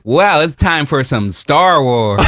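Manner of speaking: slow, drawn-out film-title line; mock title drop. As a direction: hammily spell out the title for the audience. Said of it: "when they were like".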